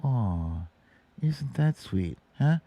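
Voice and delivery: usual speaking voice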